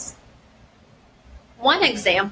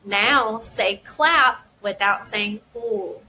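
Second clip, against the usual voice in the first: slow, clear instruction, word by word; slow teacher prompt; slower than usual. Shown as a demonstration. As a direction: guide a child slowly through the task. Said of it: "The teacher would say".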